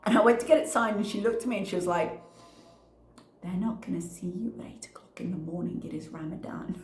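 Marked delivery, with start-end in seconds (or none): 3.4-6.8 near-whispered, much softer; imitating a hushed, breathy warning; hushed reply. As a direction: say it under your breath, almost whispering. Acting out head's hushed reply.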